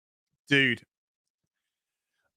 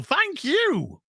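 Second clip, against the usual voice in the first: squeaky high thanks; 12 semitones higher than usual. Real, not acted. Repeated thanks, highest pitch.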